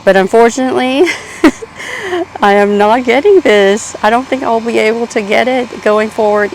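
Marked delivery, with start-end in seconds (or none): 1.1-2.4 short, high-pitched laugh; rueful laugh. Real, not acted. Gardener admitting plant disappointment to viewers, short high laugh. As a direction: laugh off a small letdown.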